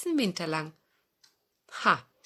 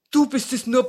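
louder, strange fox voice; old fox; 6 semitones higher, 10 dB louder than usual. Acted. Fox recognizes the old dog, relieved fox voice. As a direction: shrug it off like a sly old fox.

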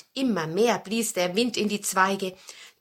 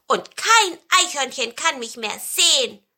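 high, loud, gleeful storybook voice; delighted pine cone; 6 semitones higher, 7 dB louder than usual. A narrator's reading. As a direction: crow happily from a safe hiding place. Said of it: "der Tannenzapfen freute sich". (hohe, vergnügte Tannenzapfenstimme)

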